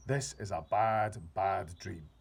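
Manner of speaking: loud, slow emphatic dread; dread-filled cry. A narrator's reading. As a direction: stress the bad slowly. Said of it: "he cried".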